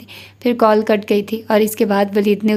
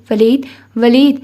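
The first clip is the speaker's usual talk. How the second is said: louder, drawn-out call rising at the end; slow, raised-voice cry of a name; 5 dB louder than usual. A narrator's reading. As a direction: call urgently after someone who is gone. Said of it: "وہ پکارتی رہ گئی".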